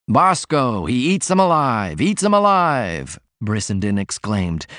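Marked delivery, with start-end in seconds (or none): 0.1-3.2 barker's high sing-song pitch, climbing on the last cry; carnival spieler. Acted. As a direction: hawk it like a sideshow barker. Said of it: "imitating the spieler of a locally famous snake-eater".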